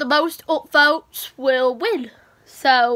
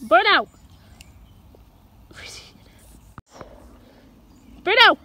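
calling the dog; 5 semitones higher than usual. Real, not acted.

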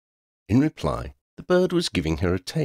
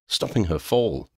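ordinary delivery, nothing marked

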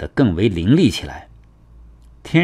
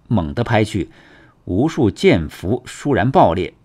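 usual speaking voice

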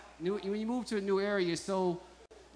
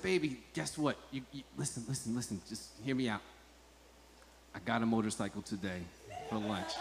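quiet, low, cautious coaxing; sheepish confession; 9 semitones lower, 10 dB quieter than usual. Retold or imitated. Husband confessing a lunch-break purchase to his wife, sheepish and hushed. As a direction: break bad news softly and gingerly.

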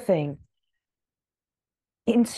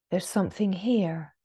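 normal talking voice

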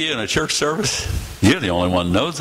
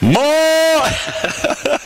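high, drawn-out shout breaking off; booming holler. Acted. Preacher mimicking God's announcement, booming holler. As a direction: start a huge heavenly bellow.

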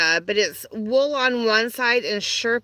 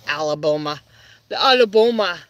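mock Southern voice; 5 semitones lower, 3 dB louder, faster than usual. Jokey voice for Alabama.

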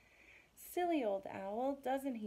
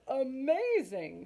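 slow, high, louder marvelling; amazed; 5 semitones higher, 6 dB louder, slower than usual. Acted. Gruffalo marvels that snake fled from mouse, amazed. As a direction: draw the word out in wonder.

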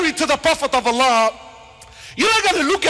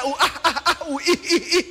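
mock giggling. Mimicking wives giggling at barbecue.

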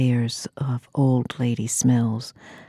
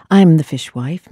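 loud proud declaration; 8 dB louder than usual. Acted. Bold declaration after whisper.

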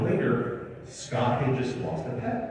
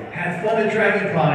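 high, loud, breezy announcement; mock-cheerful social media shout-out; cheery celebrity post; 8 semitones higher, 6 dB louder than usual. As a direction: toss it out brightly like a happy post.

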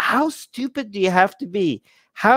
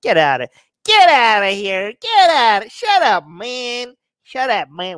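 mock dismissive shout; 4 semitones higher than usual. Telling fans who call him Aryan to get out, scoffing mock shout. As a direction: shoo them off with a scoffing cry. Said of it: "I'm like".

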